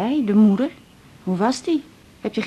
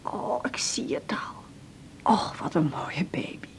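soft slow whisper of delight; whispered admiration; 2 semitones lower, 10 dB quieter than usual. Acted. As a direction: marvel quietly at the baby. Whispering over sleeping baby. (fluisterend vol bewondering)

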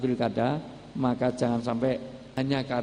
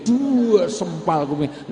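1 slow mocking emphasis; 4 semitones higher, 5 dB louder, much slower than usual. Mocking the firecracker fool.

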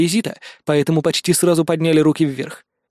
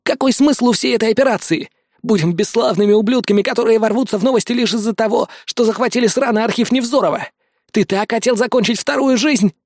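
higher-pitched agitated character voice; agitated young man; 6 semitones higher than usual. Acted. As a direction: raise the voice high and protest indignantly. (высокий возмущённый голос персонажа)

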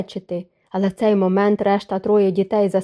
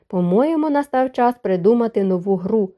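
plain reading voice

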